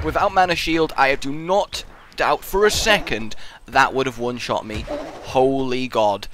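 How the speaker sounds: ordinary talk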